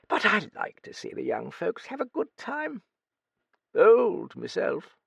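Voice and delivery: put-on high, crusty old British lady voice; old Duchess. A narrator's reading. Old Duchess quizzing the young writer, high crusty voice. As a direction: play a brusque, nosy old dowager.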